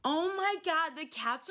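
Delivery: high, loud sudden exclaim; sudden alarmed oh my God; startled by cats. Real, not acted. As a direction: caught off guard by the cats. Cats running around.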